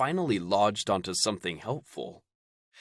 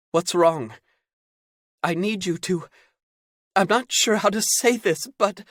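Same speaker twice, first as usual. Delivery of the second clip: put-on anxious young man; 11 semitones higher, 6 dB louder than usual. Caden anxious on phone.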